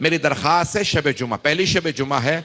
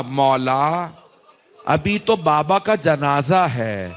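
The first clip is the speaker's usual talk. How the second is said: slow, drawn-out mourning appeal rising in pitch; majlis lament; 3 semitones higher, slower than usual. Acted. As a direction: plead to Abbas, stretching each word in grief. Addresses Abbas in lament. (کھنچی ہوئی دردناک فریاد)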